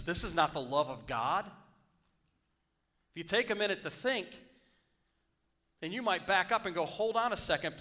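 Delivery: rally the congregation to think; impassioned sermon; preaching at full force. Acted. Sermon rising again.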